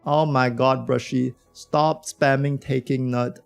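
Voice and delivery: ordinary talk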